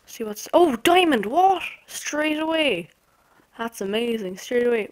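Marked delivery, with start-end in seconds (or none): 0.5-2.9 loud, high burst of delighted disbelief; excited surprise. Real, not acted. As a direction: cry out at a lucky find. Spots diamond on landing.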